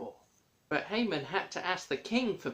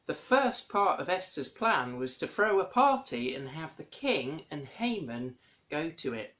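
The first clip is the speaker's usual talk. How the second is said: usual speaking voice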